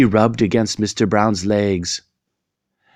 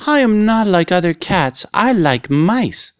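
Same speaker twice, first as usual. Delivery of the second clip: cat character; 8 semitones higher than usual. A narrator's reading. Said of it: "But the cat said".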